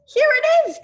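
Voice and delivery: excited cry. A narrator's reading. Christopher Robin finding the present, excited cry. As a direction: burst out with delight. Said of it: "cried Christopher Robin excitedly".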